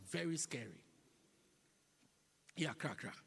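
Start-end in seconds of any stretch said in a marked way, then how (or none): none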